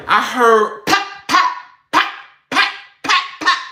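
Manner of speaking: sharp high gunshot pops; imitating gunfire pops; imitated pops. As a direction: pop them out like shots.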